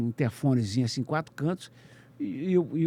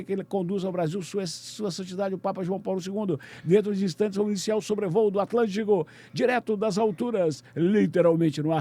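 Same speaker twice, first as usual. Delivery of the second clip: radio announcer; 3 semitones higher than usual. Retold or imitated. Reporter going live on radio from the Pope's plane, announcer style.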